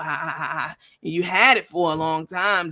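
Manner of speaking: normal talking voice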